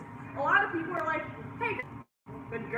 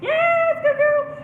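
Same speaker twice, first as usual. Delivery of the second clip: high, loud yes and praise; excited praise; 13 semitones higher, 12 dB louder, much slower than usual. Shown as a demonstration. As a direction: beat the leaves for her attention. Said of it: "We'll be more excited than the leaves".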